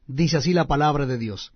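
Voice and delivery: plain reading voice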